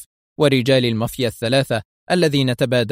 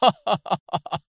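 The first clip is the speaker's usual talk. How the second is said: staccato, high-pitched acted laugh; a performed "ha ha ha" laugh; hearty mock laugh; 7 semitones higher than usual. A narrator's reading. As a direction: laugh out scornfully in a string of ha-has. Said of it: "ضحك أدهم ضحكة عالية".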